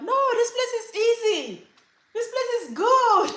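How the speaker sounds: put-on loud, very high-pitched kid voice; insistent child. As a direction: protest like a little kid, hammed up.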